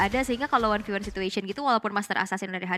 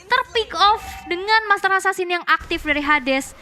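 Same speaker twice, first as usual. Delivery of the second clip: rising hype on the big play; esports caster; 5 semitones higher, 4 dB louder than usual. Acted. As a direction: call the action, build to the kill. Casts the match play-by-play. (komentator esports yang bersemangat)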